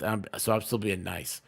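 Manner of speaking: normal talking voice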